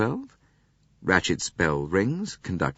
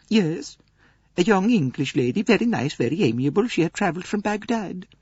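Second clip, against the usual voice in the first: much higher, gentle Swedish lady; Greta Olson voice; 12 semitones higher than usual. Acted. Swedish lady answering Poirot's questions, high gentle voice. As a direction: lift into a mild, earnest Swedish companion.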